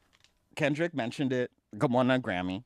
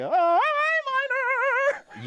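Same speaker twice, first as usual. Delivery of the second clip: put-on high, slow whiny little-kid voice; playful high childish pout; childish mock voice; 5 dB louder, much slower than usual. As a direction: whine it like a sulky child. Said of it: "you're childish".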